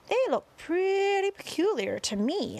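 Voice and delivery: high-pitched onlooker's voice; man with binoculars. A narrator's reading. Man peering through binoculars gives his verdict, high onlooker voice. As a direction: pitch it well up, as a puzzled onlooker.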